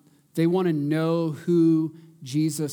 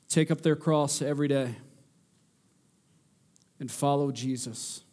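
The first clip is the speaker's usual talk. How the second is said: earnest pleading prayer; 3 semitones lower than usual. Real, not acted.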